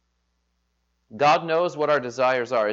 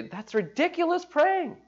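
scoffing; 3 dB quieter than usual. Retold or imitated. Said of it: "You'd say".

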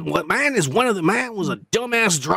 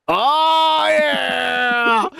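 excited shout; 6 semitones higher than usual. Real, not acted. Fan shouting after quoting his favorite movie, excited. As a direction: let out a thrilled shout. Reacts to quoted movie line.

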